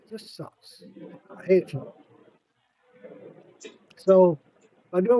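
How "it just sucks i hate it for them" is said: a whispered repeat of It just sucks. Real, not acted. Grieving for friend's family, whispered repeat. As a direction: breathe it out as a whisper.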